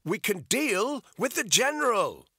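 high, loud shout. A narrator's reading. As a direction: call the offer out over the rain. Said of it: "he shouted".

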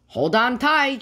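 high, urgent warning call; storybook shout. A narrator's reading. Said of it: "she shouted".

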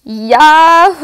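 loud gleeful yahoo; joyful whoop. Acted. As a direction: whoop for joy as you splash. Pig splashes in mud.

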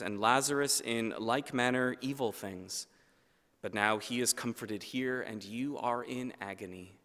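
normal talking voice

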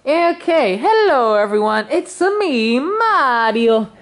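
put-on high, swooping Italian accent; Mario. Mario says hello to everyone, showy Italian accent. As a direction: announce yourself in hammed-up cartoon Italian. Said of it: "stop doing the Italian face voice too".

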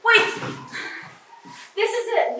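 ordinary talk

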